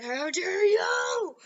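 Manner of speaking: high, long-drawn rising "you"; outraged battle cry. Acted. Deku charging the Nomu, outraged cry. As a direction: stretch it into a soaring cry of outrage.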